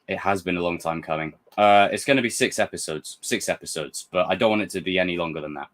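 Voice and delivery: normal talking voice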